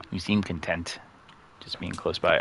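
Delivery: normal talking voice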